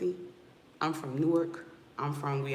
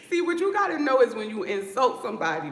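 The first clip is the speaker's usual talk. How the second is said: raised, higher voice hitting back; loud comeback; 7 semitones higher, 7 dB louder, faster than usual. Real, not acted. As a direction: fire back loudly at the heckler. Hitting back at an insulting commenter.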